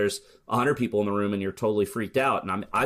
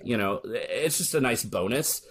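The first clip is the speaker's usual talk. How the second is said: usual speaking voice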